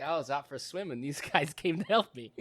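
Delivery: husband downplaying the rescue to wife; mock innocent excuse. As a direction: shrug it off sheepishly.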